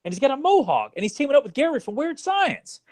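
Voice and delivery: retelling his stunned reaction in a high voice; a hammed-up what-am-I-seeing voice; incredulous disbelief. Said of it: "It's like".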